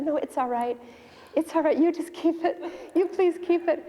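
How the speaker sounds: high giggly give-in; laughing relief. Retold or imitated. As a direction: let the worry melt into a laugh. Wife gives in, laughing.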